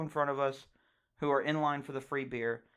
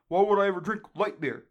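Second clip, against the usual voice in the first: higher, louder scoffing dad voice; mocking dad; 6 semitones higher, 7 dB louder than usual. Dad scoffing at Bud Light, a loud mock gripe. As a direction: ham up dad's pet line. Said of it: "his favorite thing to do".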